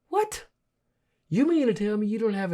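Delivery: sharp high disbelieving what; incredulous. Real, not acted. Host reacting to an arrogant reporter answer, incredulous.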